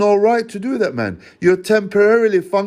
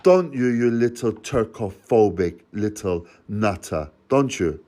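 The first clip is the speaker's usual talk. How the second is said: quiet contemptuous jeer; mocking the caller in a low voice; low sneering taunt; 7 semitones lower, 4 dB quieter than usual. Real, not acted. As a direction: drop low and sneer the insult. Taunting the social worker on phone.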